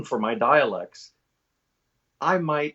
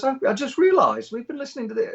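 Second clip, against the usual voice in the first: high British woman, suddenly realizing; high-pitched voice of dawning realization; J K Rowling; 12 semitones higher, 3 dB louder than usual. Retold or imitated. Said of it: "It's, she goes".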